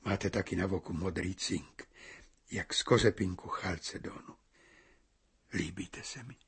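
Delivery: hushed, breathy whisper; whispered confession. Acted. Young man whispers to the bride that he likes her. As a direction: let it fall to a whisper.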